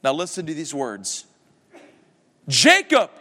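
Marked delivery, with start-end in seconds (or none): 2.5-3.1 higher, louder pleading call; imploring. Acted. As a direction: beg him by name. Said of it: "Scrooge implored".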